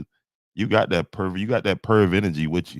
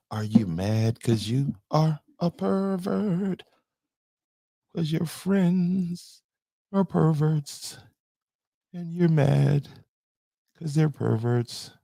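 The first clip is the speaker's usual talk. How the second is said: much higher teasing taunt; mocking high voice; 10 semitones higher than usual. Real, not acted. Streamer taunting a viewer's friends, high squeaky jeer. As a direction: needle him in a squeaky voice.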